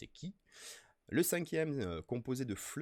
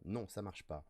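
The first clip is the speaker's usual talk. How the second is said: ordinary talk, nothing marked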